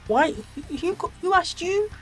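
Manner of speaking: mock outrage, rising high; incredulous retort. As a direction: pitch jumps way up on the last words. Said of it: "And I'm like".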